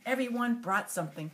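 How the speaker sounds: ordinary delivery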